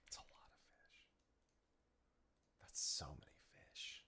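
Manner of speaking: hushed awed whisper. Pretend. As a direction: breathe it out in awe. Joking hushed awe at fish count.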